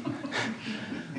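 soft airy chuckle; quiet amused huff; breathy laugh. Real, not acted. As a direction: laugh under the breath at the joke. Laughs at his own joke.

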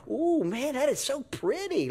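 high, soft cooing wonder; fascinated awe. Acted. Child tempted by a bright cherry red lighter, fascinated. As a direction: coo over something irresistibly pretty.

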